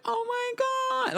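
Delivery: very high drawn-out gasp; dramatic voice. Retold or imitated. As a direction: stretch it high and slow. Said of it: "she made a whole voice moment".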